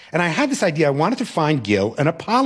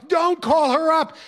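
higher and slower, stretched-out begging; teen begging his teacher again, higher and slower; more desperate wail; 12 semitones higher than usual. Retold or imitated. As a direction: plead harder, drawing it out. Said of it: "the wailing started again".

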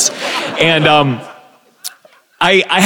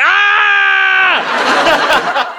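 high yelped scream of a man falling; a mock freefall scream, much higher; imitated terrified scream. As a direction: let out a short shrieking ah. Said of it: "the last thing I'll think is just like".

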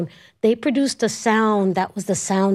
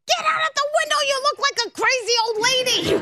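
high mock scold, quick on the second line; husband scolding; much faster than usual. Husband telling her to leave the window, mock scolding. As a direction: tease like a nagging husband. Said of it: "And my husband goes".

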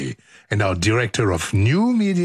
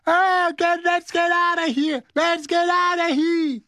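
mock yelling. Host acting Sam escaping the fire, mock high yelling. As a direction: squeal it in mock panic, held high.

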